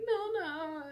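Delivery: high, choked call of her name; calling her sister through tears; a high crying plea; tearful call. Retold or imitated.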